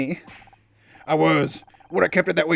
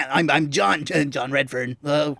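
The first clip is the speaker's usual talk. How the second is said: stammering nerdy fanboy introduction; bumbling cartoon-nerd voice, words tripping over themselves; Professor Frink; 2 semitones lower, slower than usual. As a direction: stutter it out like a flustered superfan. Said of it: "maybe he should sound like Professor Frink".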